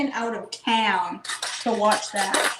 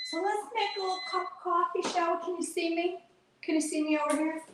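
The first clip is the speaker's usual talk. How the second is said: sing-song; 8 semitones higher, 5 dB quieter than usual. Real, not acted. Sing-song chat to viewers.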